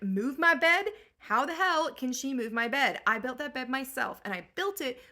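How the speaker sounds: loud, outraged shout; Odysseus bursts out, furious over the bed; rage. Retold or imitated. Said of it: "Odysseus is in a rage".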